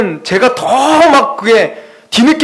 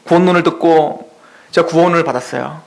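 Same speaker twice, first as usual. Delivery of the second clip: ordinary talk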